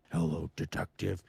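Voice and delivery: low deep villain voice. Shown as a demonstration. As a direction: drop it low and menacing. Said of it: "deep voice".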